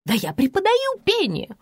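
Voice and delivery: offended, pitch climbing sharply; affronted outburst; indignant hiss. A narrator's reading. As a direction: bristle at being contradicted, rising high. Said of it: "прошипела тётка".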